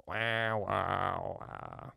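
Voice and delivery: fading mock instrument wails; imitated instrument drone. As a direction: mimic a wailing instrument. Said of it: "instruments that just sound like a".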